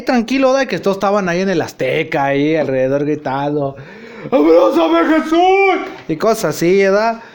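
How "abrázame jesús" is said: high, loud ecstatic shout; imitating a worshipper's cry. Worshippers at the stadium rally cry out, high and loud. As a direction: cry out to Jesus like a fervent believer.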